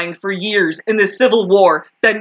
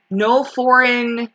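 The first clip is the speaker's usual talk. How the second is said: ordinary talk, nothing marked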